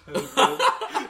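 high, loud greeting through laughter; cracking up mid-impression; laughing mock greeting. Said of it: "and just goes".

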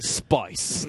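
Australian accent, "Spice" whispered. Shown as a demonstration. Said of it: "just being like".

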